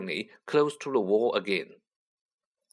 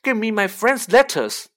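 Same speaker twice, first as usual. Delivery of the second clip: angry; 9 semitones higher, 7 dB louder than usual. A narrator's reading. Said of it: "said Harry angrily".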